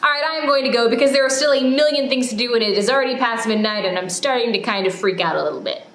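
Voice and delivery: ordinary talk, nothing marked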